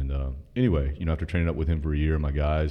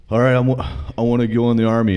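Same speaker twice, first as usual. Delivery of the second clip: higher and louder, bursting with eagerness; eager announcement; 8 semitones higher, 5 dB louder than usual. Retold or imitated. Young recruit telling the recruiter he wants the Army, eager and loud. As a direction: announce it like a gung-ho kid.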